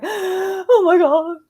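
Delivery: high, drawn-out gasping awe; overwhelmed shock. Retold or imitated. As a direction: stretch it out slowly, breath caught. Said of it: "your heart starts pounding super, super fast".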